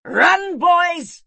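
very high, loud urgent command; queen's yell. A narrator's reading. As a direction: yell a warning across the battlefield to save them. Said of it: "yelled the queen".

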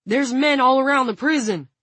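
high, loud shout. A narrator's reading. Frank calling on the guard to surrender, shouting. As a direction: call out forcefully across a firefight. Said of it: "Frank shouted".

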